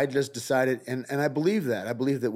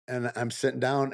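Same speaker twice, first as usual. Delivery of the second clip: usual speaking voice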